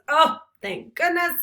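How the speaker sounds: a hammed-up sigh of rescue; relieved servant. Servant relieved Romeo can read.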